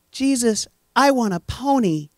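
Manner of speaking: high, put-on kid voice; childish pleading prayer. Mimicking a selfish prayer to Jesus, childishly. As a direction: wish for it like a small child.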